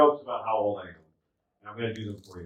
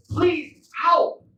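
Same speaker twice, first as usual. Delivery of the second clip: very high, loud cry for help; pleading old lady; 5 dB louder than usual. Retold or imitated. Old lady begs a visitor for help, high mock plea. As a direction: imitate an old woman's helpless plea.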